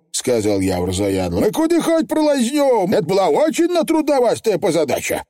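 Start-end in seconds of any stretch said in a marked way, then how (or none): 1.4-5.2 high, cocky little Feegle; squeaky, eager pixie chief; high cheerful Feegle boast. Acted.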